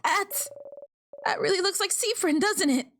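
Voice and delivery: high-pitched, excited pointing-out; excited discovery. Acted. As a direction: call out the startling sighting. Spotting the ghost again, acted.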